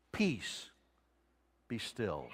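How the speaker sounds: soft, low, barely above a breath; calm the storm with a hush; hushed command. Acted. Jesus speaking to the sea, quiet and commanding.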